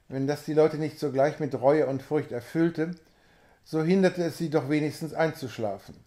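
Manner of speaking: plain reading voice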